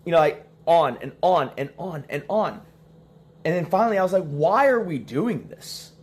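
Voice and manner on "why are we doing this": higher, slow, drawn-out plea; exasperated. Retold or imitated. Husband after repeating himself on and on, exasperated. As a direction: stretch it out in mock exasperation.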